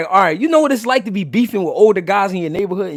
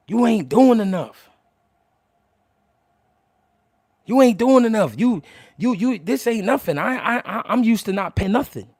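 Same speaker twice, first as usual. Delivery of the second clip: put-on frustrated girlfriend; 4 semitones higher, 3 dB louder than usual. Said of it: "and she told me".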